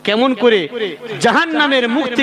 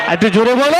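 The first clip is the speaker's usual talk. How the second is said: higher, rising crowd call; rousing call-out; 3 semitones higher, faster than usual. Real, not acted. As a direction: call out to the crowd, lifting the last word. Urges crowd to answer louder.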